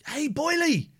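high, bright call of a name; excited greeting. Real, not acted. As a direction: call out to a friend with glee.

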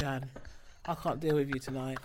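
low, soft weary protest; quiet exasperation. Real, not acted. Telling the co-host off, quiet exasperation. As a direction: say it under your breath, low and fed up. Mock exasperation at co-host's remark.

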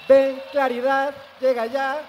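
high, loud, slow, solemn call; mock invocation. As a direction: summon the dawn like a prophet. (invocación solemne y burlona)

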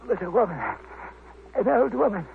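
weak dying voice. Acted. Dying man gasps out his story.

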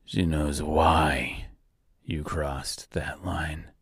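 lie broken and leaking oil, taunting her quietly; dying Tin Woodman. Acted.

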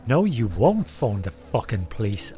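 loud threatening rant; menacing shout. Acted. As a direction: bellow the threat. Guest threatens the family.

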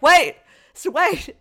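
high, loud frantic stalling; over-excited, insistent plea to stay; pestering movie. Retold or imitated. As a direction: keep begging the audience to wait. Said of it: "The movie was like".

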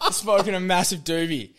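barely getting it out for laughing; real giggle carrying the phrase; laughing through words. Real, not acted. Laughing through the punchline setup.

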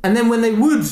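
ordinary delivery

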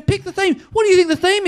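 high-pitched, insistent demand; imitated woman heckler. Woman orders the comic about, imitated in a high voice. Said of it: "one woman screams at me".

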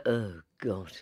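low, soft mock dismay; groaned-out disapproval, acted low and quiet; dismayed reaction. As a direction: imitate someone sinking at the sight of you. Judges' reaction to the accent.

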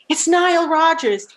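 high, emphatic retold protest; incredulous protest. Singer arguing with producer over Nile, incredulous. Acted argument with her producer.

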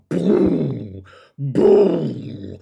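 long, loud booming noise; booming car bass. Retold or imitated. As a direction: imitate thumping car bass, drawn out.

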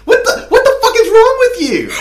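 high, loud horrified shriek, half laughing; a man recoiling at the urinal in disgust; shocked stranger. Pretend. Stranger at the urinal sees the cage, shrieks in horror.

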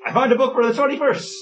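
very high-pitched, sudden burst; real excitement, voice shooting up; excited announcement. Real, not acted. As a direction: blurt it out way up high. Suddenly announces he found a book.

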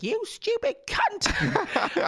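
very high, very slow mock screech of an old granny; furious old granny. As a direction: drag out the insult like a furious old lady. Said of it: "she's like".

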